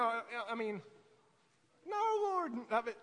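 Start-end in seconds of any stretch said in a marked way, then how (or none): 1.9-2.5 higher-pitched, mild protest. Retold or imitated. Preacher answering the Lord about one sheep, mild protest. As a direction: plead against it, pitched up.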